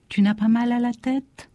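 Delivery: a mother's gentle fretful question; anxious mother. Acted. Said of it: "main fraîche sur mon front".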